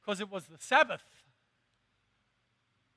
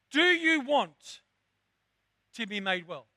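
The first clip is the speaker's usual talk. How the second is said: slow, weighty question; slow, deliberate question; 4 dB louder, slower than usual. Retold or imitated. Jesus asking the man, slow deliberate question. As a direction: lay each word down slowly. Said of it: "All he asked them is this".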